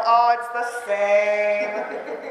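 put-on slow, drawn-out kid voice with a laugh; knowing kids. Teacher imitating kids who already know, slow and amused.

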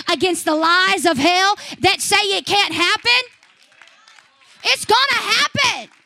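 loud fiery preaching; preaching at full force. Acted. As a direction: drive it home to the church. Preaching climax, loud declarations.

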